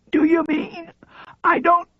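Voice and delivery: high, thin voice of a frail paralysed man; frail high voice, rattled; frail old man. A narrator's reading. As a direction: squeeze it out high and weak. Paralysed man's weak high voice.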